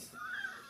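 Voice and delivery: shrieking laugh, mouth full; sudden high laughing squeal. Real, not acted.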